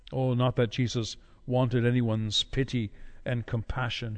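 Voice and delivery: ordinary talk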